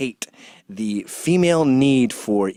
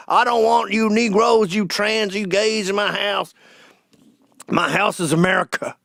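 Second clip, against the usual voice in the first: higher, put-on voice of a cartoon bigot from an ad; mock bigot; 7 semitones higher than usual. Comic mocking ads in a higher cartoon-bigot voice. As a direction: sneer it like a commercial's villain. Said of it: "cuck commercials where we're idiots".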